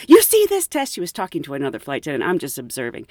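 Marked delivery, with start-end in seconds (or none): 0.1-0.9 high, loud mock outrage; imitating angry coworker. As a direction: throw the question at her, indignant. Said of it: "She was saying".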